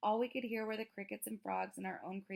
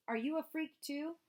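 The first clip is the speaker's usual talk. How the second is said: 1 sneering taunt; 8 semitones higher, 4 dB louder than usual. A narrator's reading. Bully sneering at Amos.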